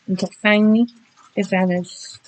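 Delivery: usual speaking voice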